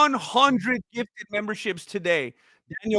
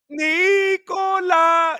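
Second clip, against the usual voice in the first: long stretched shout of the name, much higher and louder; drawn-out shout; 12 semitones higher, 6 dB louder, much slower than usual. Real, not acted. Streamer shouting out a viewer's name with a long high stretched call. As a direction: hail the name across the room, held long and high.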